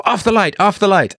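put-on high, lightly accented "Off the light"; imitating Turkish friend. Recalling a Turkish childhood friend telling him to switch off the light, lightly imitated. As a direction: mimic a childhood friend's odd phrasing. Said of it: "I remember he used to say".

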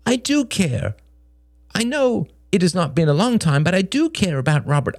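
high, earnest protest; insistent Greg. A narrator's reading. Narrator voices pleading Greg.

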